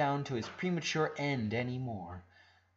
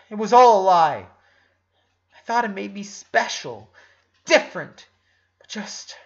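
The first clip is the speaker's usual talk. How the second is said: loud, high, anguished cry; anguished outburst; 6 semitones higher, 9 dB louder than usual. Acted. Lincoln, falling apart before Ronnie Ann, anguished shout. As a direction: shout out the betrayal, cracking.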